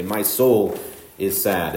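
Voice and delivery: "My soul is sad" said slowly, with the weight on "soul"; emphasized, slow. Shown as a demonstration. Preacher repeating his example, slow with stress on "soul". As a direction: linger on "soul" in "My soul is sad" so the sorrow sounds intense. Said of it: "you're emphasizing the intensity of your sadness".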